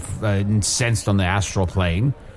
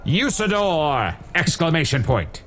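booming name call; 7 semitones higher than usual. Acted. Wizard bellows his own name.

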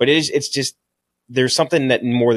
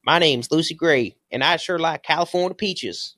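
mocking the actress with a theatrical Southern lilt; stagey Southern accent; 5 semitones higher than usual. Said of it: "stagey Southern voice".

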